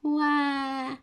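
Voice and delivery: put-on loud high baby wail; baby crying wail. A narrator's reading. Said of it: "rozpłakał się Franek".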